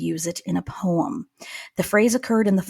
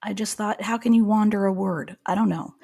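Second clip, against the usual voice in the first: ordinary talk, nothing marked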